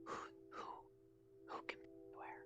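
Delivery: very soft, voiceless question; whispering. Acted. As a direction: ask barely audibly.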